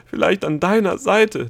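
high, loud and drawn out; appalled exclamation. Acted. Gnome dreading eternity beside Delia, appalled. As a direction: spit out the prospect in horror.

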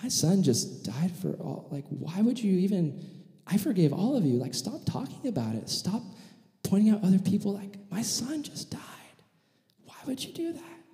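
pleading father, pitch climbing into a hushed voice; acted grieving judge, fading to a near-whisper; tearful hurt. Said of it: "he's got tears in his eyes".

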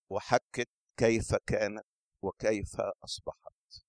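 ordinary delivery

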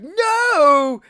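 high, loud drawn-out wail; losing it. Retold or imitated. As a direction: cry out as if losing it. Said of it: "he starts to, like, lose it".

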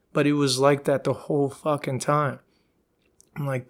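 usual speaking voice

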